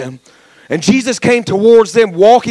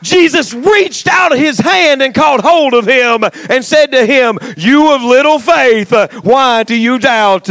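full-force preaching; 4 semitones higher, slower than usual. Acted.

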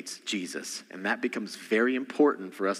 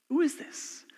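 high, hushed, wondering who is this; high wondering question; 4 dB quieter than usual. Shown as a demonstration. Preacher repeating the disciples' question to the congregation, high and hushed. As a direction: ask it high and soft in wonder. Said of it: "Very important".

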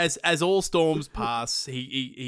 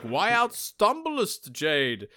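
mock archaic villain; 6 semitones higher, much slower than usual.

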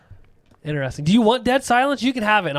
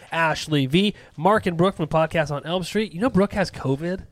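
usual speaking voice

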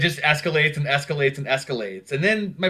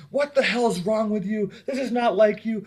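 pitched-up imitation of an angry sister; half-acted furious rant, voice way up; mock sister yelling; 8 semitones higher than usual. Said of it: "my sister started yelling at me".

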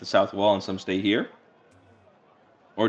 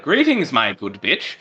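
mock noble greeting; 4 semitones higher, 4 dB louder, slower than usual. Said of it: "I am so tempted to walk up and be like".

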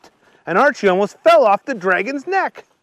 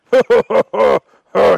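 loud ho-ho-ho laugh; dragon laughter; 3 semitones higher, 7 dB louder, faster than usual. A narrator's reading. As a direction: boom out a hearty ho-ho.

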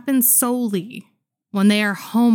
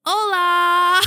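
put-on high, bright little-girl hola, a bit louder; child's cheery hola; 11 semitones higher, 6 dB louder than usual. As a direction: chirp hola like a proud small child. Child voiced greeting in story.

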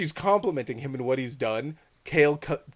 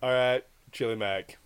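deflated, fading to very soft; low-energy resigned trail-off; resigned acceptance; 5 semitones lower, 6 dB quieter than usual. Retold or imitated. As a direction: give in quietly, underwhelmed. Gives in to cheap choice.

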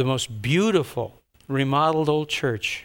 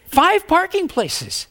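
much higher, slow, mocking; scoffing at five parking places; incredulous scoff; 4 dB louder, slower than usual. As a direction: drag it out in disbelief. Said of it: "I said".

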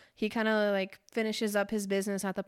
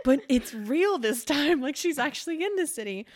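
insistent protest; 4 semitones higher, 5 dB louder than usual. Retold or imitated. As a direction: insist it's real this time. Retelling a character's insistent protest.

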